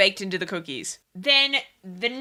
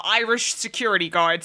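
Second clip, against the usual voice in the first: usual speaking voice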